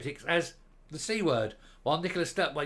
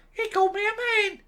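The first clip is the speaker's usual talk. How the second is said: whiny high falsetto, louder; 6 dB louder than usual. Retold or imitated. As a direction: squeal the complaint like a wounded diva.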